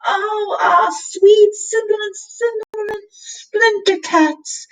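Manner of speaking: high, drawn-out intoxicated cat voice; ecstatic cat howl. A narrator's reading. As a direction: howl it slowly, blissed out. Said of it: "he howled".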